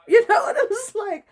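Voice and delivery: high, loud laughing outburst; laughing exclaim. Real, not acted. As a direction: burst out laughing then ask.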